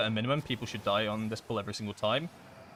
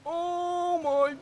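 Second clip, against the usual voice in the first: mock astonished; slower than usual. Mock awe at boss size.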